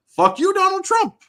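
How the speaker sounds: high, loud angry curse; defiant outburst. Real, not acted. Gamer disagreeing with Trump on video games, loud defiant outburst.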